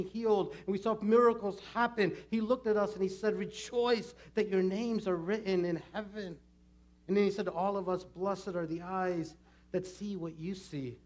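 high, loud, driving dramatic recollection; Judas, fervent. Acted. Judas recalling miracles seen beside Jesus, fervent. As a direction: build the glory days with full-voiced fervour.

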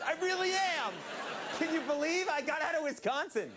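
high, loud gleeful squeal; excited kid voice. Acted. Mocking excited audience kid.

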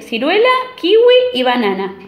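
greedy wolf rushes through his breakfast list; rushed list. Acted. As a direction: rattle off the foods quickly.

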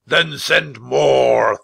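slow, booming command; huge, slow knightly roar; thundering. A narrator's reading. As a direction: thunder it out, drawing out each word. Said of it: "thundered the Christmas knight".

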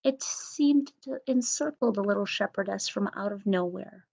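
usual speaking voice

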